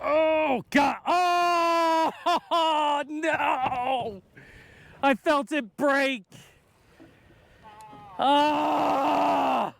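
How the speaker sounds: high, soft, crushed cry of loss; anguished dismay. Real, not acted. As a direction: groan as the big one gets away. Line breaks, fish lost.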